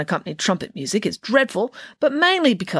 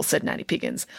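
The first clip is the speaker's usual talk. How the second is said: ordinary delivery, nothing marked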